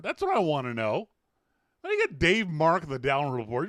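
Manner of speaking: ordinary delivery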